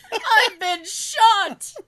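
slow, high, loud, laughing cry; mock desperate yell. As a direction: wail it like a shooting victim. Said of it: "I should have yelled that".

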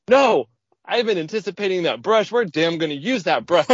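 retold with a laugh in the voice, eagerly insisting; eager protest. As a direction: protest like you can't wait for it.

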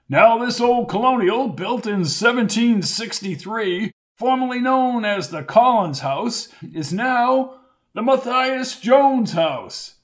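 high, projected ceremonial announcement; Hamilton making a speech. Acted. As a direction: proclaim it to the crowd, drawing out the end. Announces the house to crowd.

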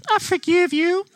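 a boy barely holding in laughter while playing mom; son faking mom.

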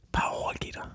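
soft unvoiced whisper of advice. Advising Wilson to surrender, in a whisper. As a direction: lean in and whisper it. Giving advice as a mock secret.

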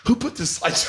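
high-pitched retold complaint, cracking up after; imitating indignant sister. Sister objecting to slideshow at funeral, mock indignant.

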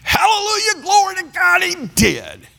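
very high jubilant shout of praise; joyful hallelujah. Retold or imitated. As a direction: burst out praising, overjoyed. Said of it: "normally I'd be saying".

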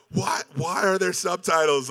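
high, loud mock outrage into a phone; imitated yell. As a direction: squawk it up high like an upset guide on a call. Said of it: "Like, yelling into the phone".

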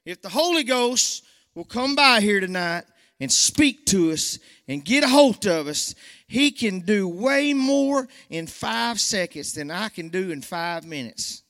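high, loud preaching with a slowed ending; a country preacher building up; full-force preaching. Real, not acted. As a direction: proclaim the Holy Ghost's power.

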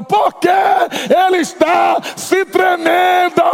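slow, high, loud inner yell; inner shout. Retold or imitated. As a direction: bellow the question inside his head. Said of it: "por dentro de mim eu tava".

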